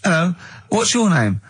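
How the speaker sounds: put-on mild sitcom neighbour, no accent; a hint of a silly neighbour at the door; light comic neighbour voice. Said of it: "didn't even do the Chinese accent".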